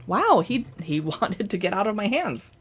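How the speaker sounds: ordinary talk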